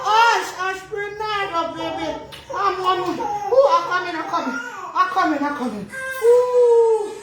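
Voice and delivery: put-on high, laughing, teary hushing of the baby; soothing frantic mother. Mother tries to calm her hungry baby Bernardo, high and teary. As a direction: coo and hush the baby in a high, breaking voice.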